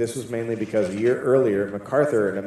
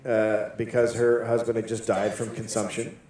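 ordinary talk, nothing marked

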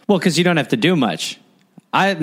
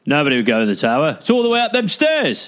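mock English guard accent. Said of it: "What was that accent?".

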